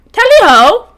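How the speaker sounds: high, loud mock-British cry; bad British accent. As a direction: call it out in a badly faked British accent. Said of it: "I can't even do a British accent".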